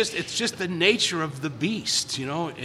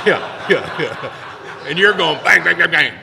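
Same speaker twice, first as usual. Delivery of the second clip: loud laughing bangs; laughing while mocking head-banging frustration; 10 dB louder, slower than usual. Real, not acted.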